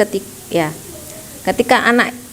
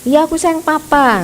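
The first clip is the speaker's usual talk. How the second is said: put-on high, sing-song little girl answer; a child's breezy high-pitched promise to papa; Zelda's voice; 9 semitones higher than usual. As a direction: chirp it up high like a sweet daughter. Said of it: "kayak Zelda".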